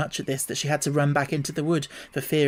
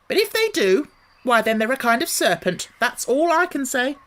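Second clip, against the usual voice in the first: high, loud insistent bird voice; accusing pigeon; 9 semitones higher, 4 dB louder than usual. A narrator's reading. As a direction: refuse to be fooled. Pigeon triumphant logic.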